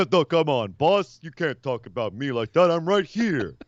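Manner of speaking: lightly voiced dopey sidekick; dim sidekick voice. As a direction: play the slow-witted henchman. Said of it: "voicing dim muscle sidekick".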